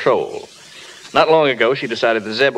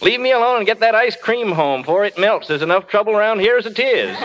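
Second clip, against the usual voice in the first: hollering; 10 semitones higher than usual. Retold or imitated. Zeb pinned under the car, yelling at the boys to get the ice cream home. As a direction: bellow it, more worried about the ice cream. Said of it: "he hollered".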